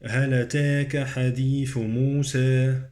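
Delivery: recited with long vowels leaning to "e" in "أتاك" and "موسى"; taqlil. Shown as a demonstration. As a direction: tilt "موسى" to a "-se" ending. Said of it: "عندي تقليل".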